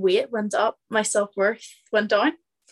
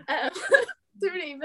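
nervous laugh breaking through; 6 semitones higher than usual. Real, not acted. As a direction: laugh it off awkwardly. Nervous laugh at own story.

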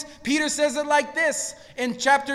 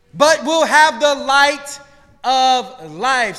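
slow, louder, weighty proclamation; preached scripture; 3 semitones higher, 8 dB louder, slower than usual. Acted. Preacher quoting Yeshua to congregation, slow proclamation. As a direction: proclaim each word to the hall.

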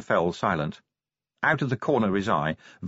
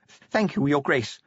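hoarse; 5 semitones higher, slower than usual. A narrator's reading. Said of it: "he said hoarsely".

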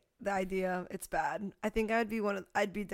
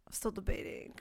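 conspiratorial whisper; 7 dB quieter than usual. Real, not acted. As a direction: whisper it, a bit softer. Confiding about selling videos.

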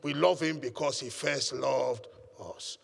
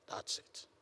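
whispered aside; 13 dB quieter than usual. Real, not acted. Quiet aside after point.

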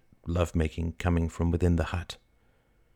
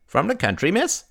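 higher-pitched, friendly market seller; old fruit vendor, chatty; 11 semitones higher than usual. Acted. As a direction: pitch it up like an old street trader.

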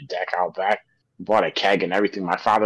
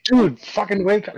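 urgent wake-up yell; 11 semitones higher, 4 dB louder than usual. Retold or imitated.

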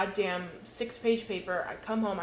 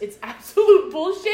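loud, high exasperation; louder and higher, fed up; heated outrage; 7 semitones higher, 11 dB louder than usual. Real, not acted. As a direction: let it burst out. Outrage over being put between.

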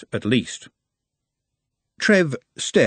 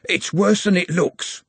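moaned; 7 semitones higher than usual. Acted. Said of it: "Carter moaned".

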